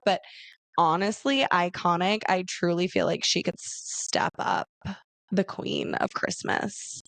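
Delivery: ordinary talk, nothing marked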